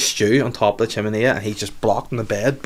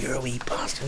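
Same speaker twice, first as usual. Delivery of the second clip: hushed, breathy muttered insult; retold boss whispering an insult; whispered insult; 3 semitones higher, 10 dB quieter than usual.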